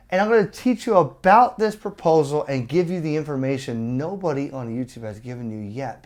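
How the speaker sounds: ordinary delivery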